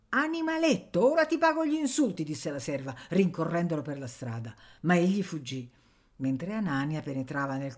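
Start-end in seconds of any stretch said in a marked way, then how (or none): 0.1-2.2 much higher, louder, fast servant's threat; shrill threat. Acted. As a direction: chase the brat down. Servant chases the boy. (serva minacciosa, voce molto più alta e rapida)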